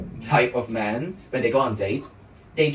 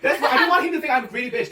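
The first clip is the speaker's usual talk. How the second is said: fast, high and laughing; 9 semitones higher, faster than usual. Real, not acted. Friends teasing her logic, she answers laughing. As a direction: rush it out through giggles.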